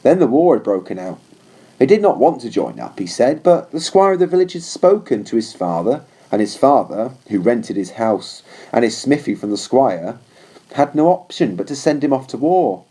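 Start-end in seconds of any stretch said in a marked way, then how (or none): none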